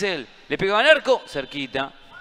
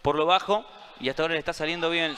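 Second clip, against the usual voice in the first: ordinary talk, nothing marked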